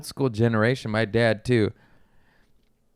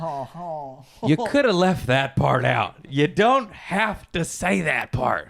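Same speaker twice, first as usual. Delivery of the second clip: slow, drawn-out mock dismay; pained protest; 4 semitones higher, much slower than usual. Buddy reacting to a date's insult, pained protest. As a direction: drag out each word in wounded protest.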